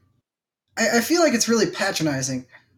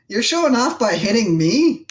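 raised, incredulous protest; indignant retort; 5 semitones higher than usual. Retold or imitated. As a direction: scoff at the big guy. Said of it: "And I'm like".

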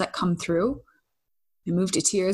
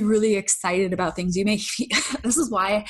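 normal talking voice

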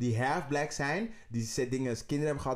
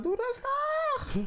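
very high, very slow squeal; mock shrill calm down; much slower than usual. Courtiers telling her to calm down, squeaky high and slow.

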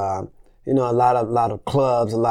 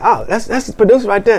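stage man; 11 semitones higher, 6 dB louder than usual. Retold or imitated. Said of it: "the stage man said".

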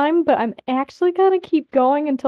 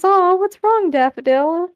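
high, cooing mock sympathy; mock concern; 6 semitones higher than usual. A narrator's reading. Cypher taunts an upset Grape with mock concern. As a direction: tease with fake concern.

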